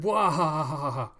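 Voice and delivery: staged laugh, loud at first then easing; mocking guffaw. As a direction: burst into a hammed-up haha. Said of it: "then just continue laughing".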